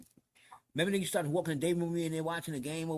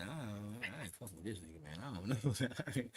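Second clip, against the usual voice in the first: low, much softer confiding; retold wary aside; 7 semitones lower, 10 dB quieter than usual. Inmate telling Rico he distrusts the man, low and quiet. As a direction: drop right down as if confiding doubts.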